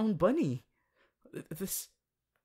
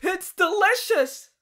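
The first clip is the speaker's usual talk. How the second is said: loud, much higher, slow gleeful cry; delighted; 12 semitones higher, 9 dB louder than usual. Acted. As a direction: squeal with delight. Tasting grass as an animal.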